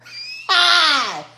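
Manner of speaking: high loud burst of laughter; big high cackle. Real, not acted. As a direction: howl with laughter. Reacts to the diss.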